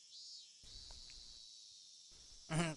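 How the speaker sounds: a soft, sly little laugh; soft knowing laugh. Acted. Mion chuckles at Keiichi, sly and knowing. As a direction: chuckle as if sharing a secret.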